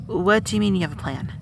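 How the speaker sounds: ordinary talk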